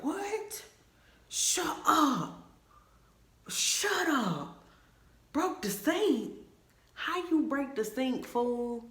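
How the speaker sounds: high incredulous "shut up" to a friend; shocked disbelief. Retold or imitated. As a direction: react like you can't believe what he just said. Retelling her shocked reaction.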